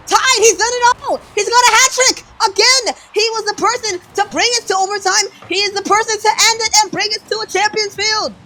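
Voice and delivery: high loud triumphant call; excited shouting. Real, not acted. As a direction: roar the hat trick. Hat trick winner call.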